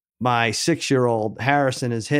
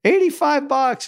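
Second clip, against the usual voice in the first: high, slow, stretched-out outrage; outraged disbelief; 13 semitones higher than usual. Real, not acted. Dad tells cohost the shoe price, high slow outrage. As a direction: say the price like you can't believe it.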